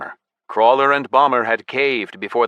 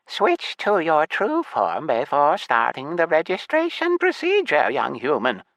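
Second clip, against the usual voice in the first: put-on slow, high-pitched elderly gnome lady; unhurried, high scolding from an old clerk; strict, raised voice; 8 semitones higher than usual. A narrator's reading. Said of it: "the gnome said strictly, raising her voice".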